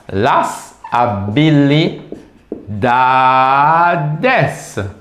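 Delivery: very slow, syllable-by-syllable "habilidades"; slow teacher's model of the word; drawn-out plural. Shown as a demonstration. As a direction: stretch the end of "habilidades" right out.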